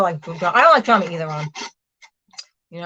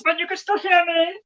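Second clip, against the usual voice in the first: raised voice, pitched up to carry; calling from a distance; 8 semitones higher than usual. Real, not acted. Streamer warns viewers they will be talking from afar, calling out. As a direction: call over to them from further away. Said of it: "talking from a distance".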